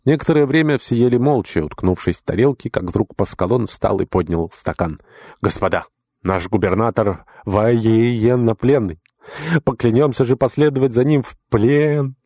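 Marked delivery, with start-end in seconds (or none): 5.4-12.2 raised, slow ceremonial toast; solemn toast. Acted. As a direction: proclaim it to the room. Pascalon's toast at table.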